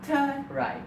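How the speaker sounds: high, synthetic navigation prompt; GPS voice. Retold or imitated. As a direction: give directions as a high-pitched satnav. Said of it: "We got the G P S".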